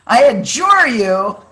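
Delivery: loud, slow proclamation, pitched high; emphatic adjuration. Real, not acted. Preacher pleading with the congregation to notice, emphatic adjuration. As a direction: proclaim it with full force, stretching ADJURE. Emphatic plea once word is found.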